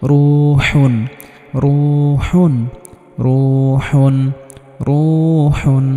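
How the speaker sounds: রুহুন with the রু drawn out one alif; long vowel in রু. Shown as a demonstration. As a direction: hold the "u" of রুহুন long, then close on হুন. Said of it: "এক আলিফ পরিমাণ টান হয়েছে".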